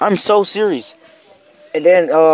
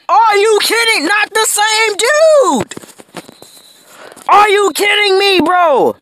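outraged disbelief; slower than usual. Real, not acted. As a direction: shout at the screen in disbelief. Opponent has the same player.

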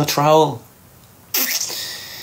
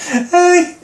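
mocking shout; 6 semitones higher than usual. Real, not acted. Mocking chat user.

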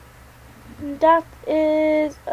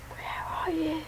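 very soft unvoiced whisper; sneak-whisper the question; 16 dB quieter than usual. Real, not acted. Searching quietly.

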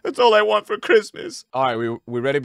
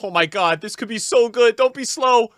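ordinary talk

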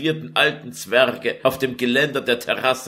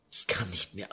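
broken and much softer, near tears; a quiet, worn-out sob of despair; sobbing; 11 dB quieter than usual. Acted. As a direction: give up on a sob. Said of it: "antwortete Lucretia schluchzend".

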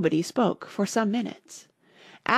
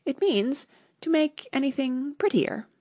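high, hesitant little Alice answer; doubtful; 8 semitones higher than usual. A narrator's reading. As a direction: feel your way through the definition. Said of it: "said Alice doubtfully".

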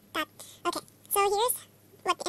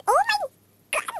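much higher and louder oh my god; fangirl squeal; 14 semitones higher, 8 dB louder than usual. Real, not acted. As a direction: squeal it out at the photo.

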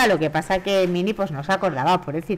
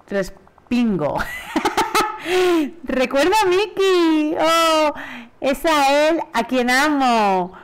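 higher, lovestruck cartoon mouse voice; a lightly higher, lovestruck girl voice; voicing Minnie; 5 semitones higher, slower than usual. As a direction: read it as a sweet, dreamy Minnie.

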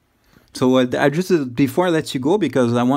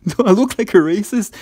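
laughing; 8 semitones higher, 4 dB louder than usual. Real, not acted. Caller mocks scammer's racism accusation.

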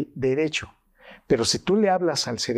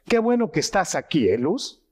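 higher, brighter welcoming tone with the name said kindly; warm welcome; 3 semitones higher than usual. Shown as a demonstration. Doctor showing prosody, warmly welcoming Luz. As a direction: greet her like you're truly glad she came. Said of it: "La manera como hablamos se llama prosodia".

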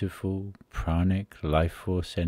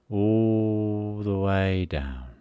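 slow, lingering meditative phrase with an elongated "all"; drawn-out guidance; 2 semitones higher, much slower than usual. Real, not acted. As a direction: stretch "all" out long and slow. Guiding the breath down the body.

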